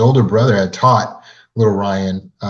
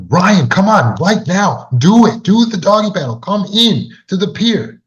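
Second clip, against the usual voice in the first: high, loud urgent yell; 10 semitones higher, 4 dB louder than usual. Retold or imitated. Older brother yelling at frozen little brother, high urgent shout. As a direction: shout him in to the pier, much higher. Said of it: "his brother starts yelling at this point".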